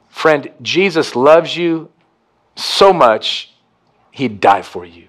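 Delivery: ordinary delivery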